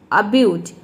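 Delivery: अब्यूज with a short schwa 'uh' at the start; schwa-start abuse. Shown as a demonstration. Teacher modelling अब्यूज for students, short 'uh' start. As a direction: say अब्यूज as 'uh-byooz', not 'ay'. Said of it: "यहाँ पे एब्यूज नहीं, अ होगा".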